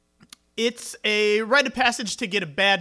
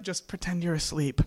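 hushed warning; 2 semitones lower, 11 dB quieter than usual. Retold or imitated. Kid to friends sleeping over as father approaches, hushed. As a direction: hush a friend quietly, as if not to be caught. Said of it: "and I'd be like".

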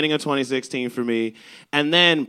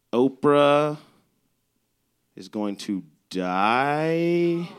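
slow punchline; 4 dB quieter, much slower than usual. Acted. Punchline held back slowly.

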